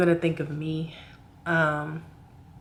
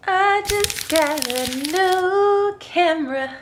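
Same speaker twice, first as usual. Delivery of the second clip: high squealing excitement, slowed; gushing over a new gift; excited; 11 semitones higher, 6 dB louder, much slower than usual. Real, not acted.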